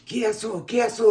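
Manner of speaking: high, loud mock mom calling out; mom yelling. Mom waking him by slapping the tattoo, high mock yell. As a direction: call out sharply like a startled mother. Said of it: "She was like".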